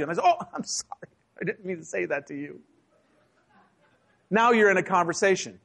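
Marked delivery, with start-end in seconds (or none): 0.2-2.6 mock apology. Acts out mock apology to sneezer.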